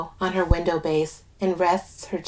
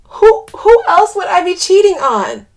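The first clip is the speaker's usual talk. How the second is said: high, loud, tearful protest; through thick tears; 11 semitones higher, 10 dB louder than usual. A narrator's reading. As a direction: stammer it out, crying and exasperated. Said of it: "she says through thick tears".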